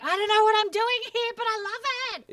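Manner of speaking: a wide-eyed festival-goer, delighted and a little lost; dazed delighted quote. Retold or imitated. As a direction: squeal it like you're lost in the music. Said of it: "you're like".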